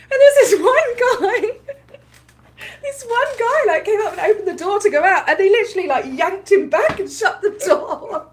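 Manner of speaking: laughing through the story, high and giggly; can barely get the words out for laughing. Real, not acted. Vlogger telling viewers a funny moment, laughing.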